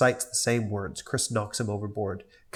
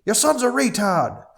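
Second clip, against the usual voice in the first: mocking character voice; 11 semitones higher, 5 dB louder than usual.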